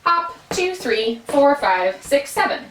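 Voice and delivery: even beats pressed, two and four leaned on; syncopated count. Shown as a demonstration. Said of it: "let's try that again".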